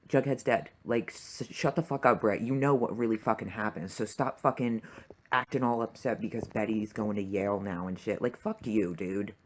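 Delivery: normal talking voice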